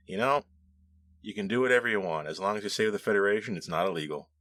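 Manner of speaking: ordinary talk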